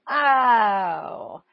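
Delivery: long, drawn-out, falling "oh" of understanding; dawning realization. Retold or imitated. Speaker recalls finally seeing God's purpose, dawning realization.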